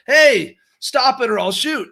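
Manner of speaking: loud, high mock warning shout; yelled threat. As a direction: yell it like an action-movie hero confronting thieves. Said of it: "yelled to the thieves to stop".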